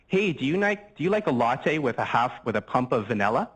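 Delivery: ordinary delivery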